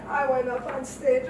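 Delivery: normal talking voice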